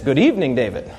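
low mechanical computer voice; robot computer voice. Pretend. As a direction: speak like a flat machine. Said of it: "the computer says".

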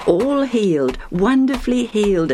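ordinary delivery, nothing marked

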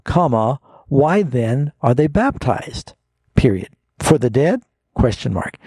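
slow, deliberate reading with each punctuation mark spoken apart; punctuated emphatic reading. Shown as a demonstration. Pastor showing where the commas go, deliberate punctuated reading. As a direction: break after "baptized", then lift "For the dead" as a question. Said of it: "Now, let me punctuate this".

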